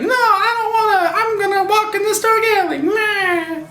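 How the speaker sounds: high, whiny mock girl voice; mock Betty. Betty turns down the cab, in a high hammed-up girly whine. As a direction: play the naive victim, hammed up. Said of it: "Betty's like".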